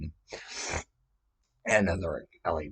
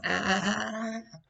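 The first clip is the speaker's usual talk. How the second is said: imitated cries; slower than usual. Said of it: "And she's going".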